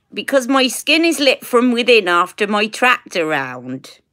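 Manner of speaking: broad West Country Somerset burr; Somerset accent. Shown as a demonstration. Woman saying a requested line about glowing skin, Somerset accent. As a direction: say it like a Somerset farmer. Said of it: "In the same accent".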